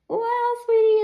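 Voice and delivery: high, loud tender sweetie; high loud affectionate endearment; tender mother. A narrator's reading. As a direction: coax the child gently. Mother answering her child tenderly.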